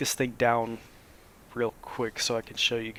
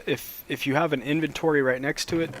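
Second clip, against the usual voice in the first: ordinary talk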